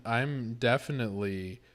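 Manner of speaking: ordinary delivery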